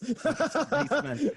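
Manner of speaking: high, far Eastern European put-on voice; Borat. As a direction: ham up a Borat voice. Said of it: "bring back Borat".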